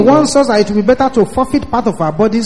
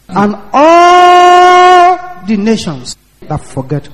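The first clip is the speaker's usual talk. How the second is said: "all" held long, much higher and louder, very slow; drawn-out preaching emphasis; 8 semitones higher, 6 dB louder, much slower than usual. Acted. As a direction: stretch "all the nations" out like a warning cry. Preacher stretching a key scripture phrase.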